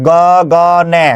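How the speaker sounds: গগনে stretched slowly into go-go-ne; slow, separated syllables in গগনে. Shown as a demonstration. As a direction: count out the syllables of গগনে by sound. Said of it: "কয়টা অক্ষর".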